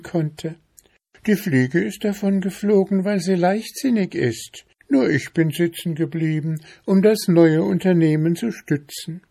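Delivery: high-pitched tiny mushroom; squeaky little voice. A narrator's reading. Little chanterelle explaining to the bear, squeaky high voice. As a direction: pipe it up in a small high voice. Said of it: "mit einem so piepsigen Stimmchen".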